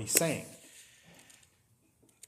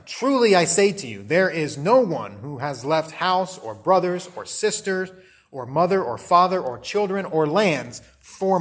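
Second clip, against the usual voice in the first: louder, measured Bible reading voice; scripture reading; 4 dB louder than usual. Acted. Preacher reading Jesus' promise to the congregation, solemn and loud. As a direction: read the verse out with weight.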